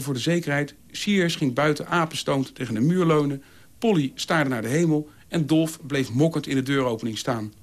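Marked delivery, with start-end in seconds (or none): none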